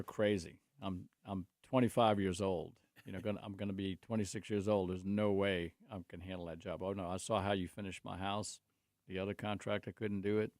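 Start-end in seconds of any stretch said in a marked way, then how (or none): none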